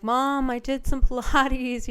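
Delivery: slow, proud announcement to mom. Retold or imitated. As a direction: savour each word telling mom the news. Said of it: "and I was like".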